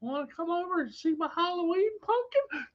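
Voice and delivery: very high, soft creep voice; high-pitched comic weirdo; creepier funny voice. Acted. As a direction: lure them in with a squeaky, creepy invitation. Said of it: "they get creepier".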